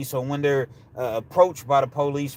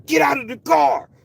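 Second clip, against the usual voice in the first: loud, high-pitched holler; screaming officer; 13 semitones higher, 6 dB louder than usual. Retold or imitated. Second officer hollering at a mother in her car, screaming. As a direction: scream the order at full tilt. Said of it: "You screaming and you hollering, you yelling".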